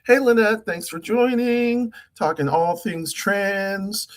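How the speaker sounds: high, welcoming voice. Shown as a demonstration. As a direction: greet a newcomer in the lifted practice voice. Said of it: "or a little bit higher consistently".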